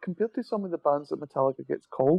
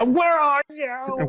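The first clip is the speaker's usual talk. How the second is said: high, slow, whiny nasal singing; 11 semitones higher, much slower than usual. Retold or imitated. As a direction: whine it like a pop-punk singer. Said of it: "best nasally voice".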